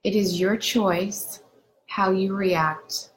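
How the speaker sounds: unhurried meditation guide with long pauses between phrases; guided meditation. Acted. Doctor leading viewers through a lakeside meditation, unhurried guided-meditation delivery. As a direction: guide them gently into calm, phrase by phrase.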